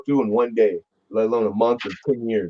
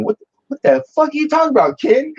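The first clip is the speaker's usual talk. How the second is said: high, startled mock outburst; shocked outburst. Retelling his stunned reply to the boss, mock outburst. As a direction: blurt it out in disbelief at the boss.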